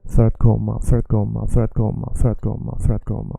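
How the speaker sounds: för att sounding like English third; för att komma like third comma. Shown as a demonstration. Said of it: "Almost as if I'm just saying third".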